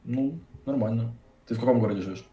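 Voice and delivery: ordinary talk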